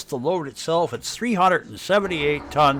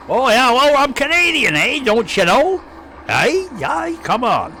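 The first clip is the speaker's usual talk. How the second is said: higher, louder hammed-up Canadian accent with "eh"; mock Canadian accent; 7 semitones higher, 4 dB louder than usual. Streamer answering a viewer's question, in mock Canadian accent. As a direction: play up the Canadian accent for chat. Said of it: "I just have this accent".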